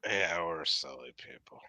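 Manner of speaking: whisper. Acted. Stage whisper aside.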